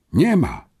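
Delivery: high, stricken exclamation; choked-out dismay. A narrator's reading. Boy finding his papers gone, choked-out dismay. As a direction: force it out in dismay. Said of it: "wykrztusił".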